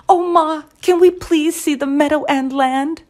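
high, eager chicks begging Mama; pleading chirp. A narrator's reading. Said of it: "they pled with wide eyes as they chirped their demand".

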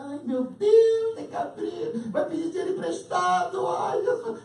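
high, loud sing-song fret; hammed-up dread of a borrower; mock panic. Neighbor panics behind the door.

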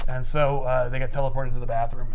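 ordinary delivery

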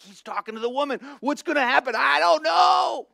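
high, loud, building to a shout; excited crowd. Retold or imitated. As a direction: tension rising in the crowd. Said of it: "They're like".

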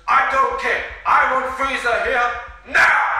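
high, very loud impatient yell; angry demand. Acted. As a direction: shout it like a furious tyrant. Sorbet angrily demands his wish.

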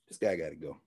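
very low, hushed mutter; private aside. Acted. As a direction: mutter it low once the call is over. Acted aside after hanging up phone.

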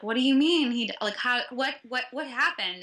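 loud, high, tripping over the questions; re-enacted panic, words breaking off and restarting; frantic disbelief. Friend retelling the reply to dad's news, frantic disbelief. Retelling shock at word of death.

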